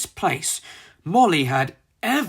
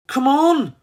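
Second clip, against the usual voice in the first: urgent; 11 semitones higher, 5 dB louder than usual. Acted. Frizzle urging Molly to run.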